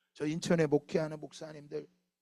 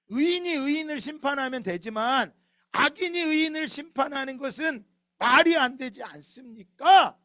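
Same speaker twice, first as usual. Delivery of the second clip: 높고 크게 따지듯 외침; 억울함에 북받쳐 하나님께 대들듯; 5 semitones higher, 6 dB louder than usual. Acted. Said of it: "하박국이 더 화가 나는 거야". (화가 난 하박국이 하나님께 따지며 높고 크게 항변)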